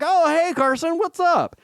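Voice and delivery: high mock-cheerful greeting; cheery dad. Dad greeting an unknown teen on the phone, high and cheerful. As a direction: chirp it brightly like an amused dad. Dad answering stranger kid.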